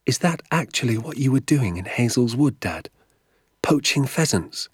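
put-on high-pitched, disbelieving boy; incredulous young boy. A narrator's reading. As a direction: question Dad in shocked disbelief. Narrator voices the boy Danny.

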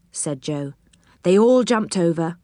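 usual speaking voice

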